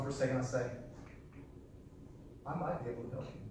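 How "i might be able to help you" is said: quiet, gentle offer of help; soft magic phrase. Shown as a demonstration. Salesman softly offering help to a talker. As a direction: say it softly, like a secret. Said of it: "That is a magic phrase".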